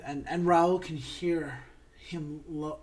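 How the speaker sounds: ordinary delivery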